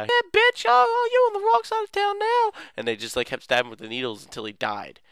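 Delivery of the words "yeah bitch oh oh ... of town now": very high squeaky trash-talk voice; cocky taunt. Acted. Taunting the boss in battle.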